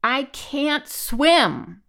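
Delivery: very slow, drawn-out high roar. A narrator's reading. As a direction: roar it out helplessly, stretching every word. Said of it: "Then he roared".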